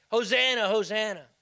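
loud, high joyful cry of hosanna; ringing hosanna shout; crowd shout. Retold or imitated. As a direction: call it out like the crowd. Crowd shouting his name.

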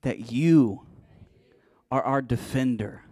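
loud, emphatic declaration in short phrases; with authority. Real, not acted. Preacher leading the congregation in repeated declarations, loud and authoritative. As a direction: proclaim it like you mean it, hitting "you" hard. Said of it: "Say it with the authority".

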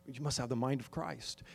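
ordinary delivery

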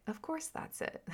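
rueful realization. Real, not acted. Wry realization, then laughs.

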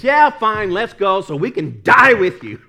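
loud, exasperated mock outburst; hammed-up fed-up disciple; Thomas. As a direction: blurt it out in loud, grudging agreement. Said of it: "And Thomas said".

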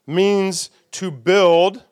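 "build" drawn out slowly with a rising end; slow emphasis. Shown as a demonstration. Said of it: "slow emphasis on meaning".

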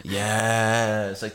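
usual speaking voice

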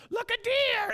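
put-on high, loud kid's outburst; excited shout. Older boys spotting an animal from the dinner table, excited shout. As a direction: yell it out like an excited boy.

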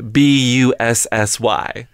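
letters spelled out slowly; slow, clear spelling, letter by letter. Shown as a demonstration. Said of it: "How do you spell it?".

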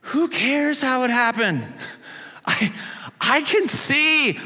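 like a man who can't believe his luck; giddy healed man. Retold or imitated. Healed blind man brushing off questions, giddy and high. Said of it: "I'm thinking he's thinking".

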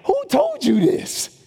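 high, slow, disbelieving; high drawn-out shocked question; incredulous. Retold or imitated. Pastor voices his disbelief.

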